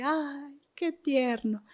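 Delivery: soft, doting coo; tender coo. Retold or imitated. Recalling Leslie's laughing baby: soft, doting coo. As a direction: melt over a giggling baby.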